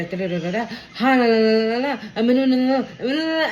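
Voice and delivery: loud hammed-up panicky la-la babbling with a laugh; mock freakout babble. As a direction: babble like someone losing it, half laughing.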